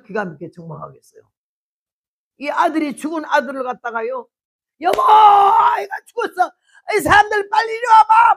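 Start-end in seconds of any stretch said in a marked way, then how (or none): 4.8-8.3 panicked shout. Acted. Mother cries out to her husband, loud and high. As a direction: scream out that the child is dead.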